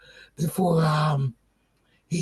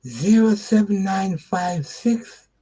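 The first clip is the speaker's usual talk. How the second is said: dictating; slower than usual. Real, not acted. Giving phone number slowly.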